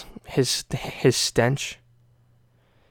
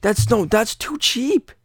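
outraged disbelief; 9 semitones higher than usual. Retold or imitated. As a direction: ham up disbelief that a masterpiece is so cheap. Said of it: "I'm like".

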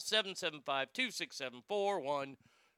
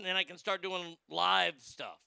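ordinary delivery

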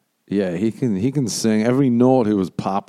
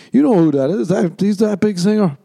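put-on drunk insisting; 11 semitones higher, 5 dB louder than usual. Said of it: "And I was like".

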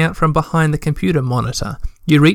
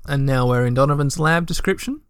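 normal talking voice

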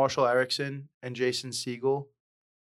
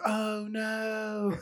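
high, softer mock English accent; terrible English accent; 8 semitones higher, 6 dB quieter than usual. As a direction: mimic a bad English accent, pitched up. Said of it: "You, you'd be like".

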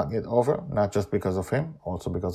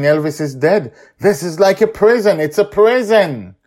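panicked despair; 11 semitones higher, 10 dB louder than usual. Acted. Trapped dreamer panics over timeline.

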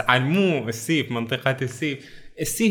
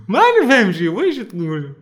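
laughing bafflement; 5 semitones higher, 6 dB louder than usual. Pretend. Mock confusion at the verse.

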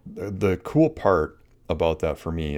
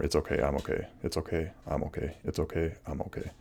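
softer and softer "It's okay. I'm okay."; fading mantra; 8 dB quieter than usual. Shown as a demonstration. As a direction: let the mantra fade to almost nothing. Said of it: "throw in three more".

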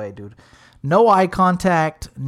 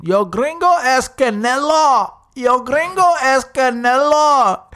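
higher, louder, slow goofy Spanish line; dumb repeat; 9 semitones higher, 5 dB louder, slower than usual. Retold or imitated. Corey repeating his joke to the Spanish-speaking girl, slow and loud. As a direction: drag out each word like a proud dumb joke. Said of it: "this dude on repeat just kept saying".

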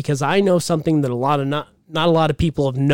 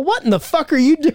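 mock outrage; 12 semitones higher than usual. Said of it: "the girlfriend's all".

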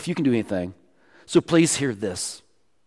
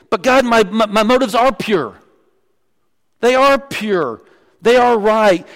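self-righteous pray-er; 6 semitones higher, 4 dB louder than usual. Retold or imitated. Preacher mocks a self-justifying prayer.

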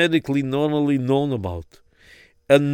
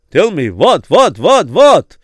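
building louder and higher; rising urgency on each what; urgent pressing; 3 semitones higher, 6 dB louder, slower than usual. Acted.

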